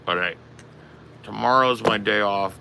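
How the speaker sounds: ordinary talk, nothing marked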